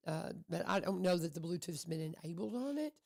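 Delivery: ordinary talk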